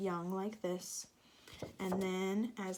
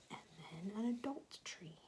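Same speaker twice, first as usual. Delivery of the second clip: whispering; 8 dB quieter than usual. Real, not acted. Explaining to child.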